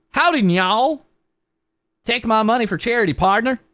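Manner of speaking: high, playful Southern cowboy twang. Pretend. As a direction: ham up the friendly cowboy. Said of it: "Cowboy voice".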